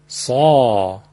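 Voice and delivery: سا deep and heavy from the throat; emphatic saa. Shown as a demonstration. Teacher demonstrating emphatic ص to listeners. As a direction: give سا full throat volume. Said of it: "پس به این ترتیب".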